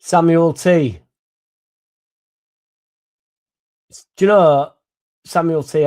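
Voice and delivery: loud greeting to a viewer; calling out. Real, not acted. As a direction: hail him across the room.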